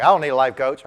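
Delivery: curt dismissal. Retold or imitated.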